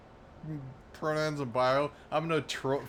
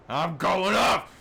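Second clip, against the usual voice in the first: loud, higher, slow shout of "off"; loud declaration; 6 semitones higher, 8 dB louder, slower than usual. Shown as a demonstration. Streamer hyping his own rant, shouting. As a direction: belt out "I'm going off!" like a battle cry. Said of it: "I'm going off today".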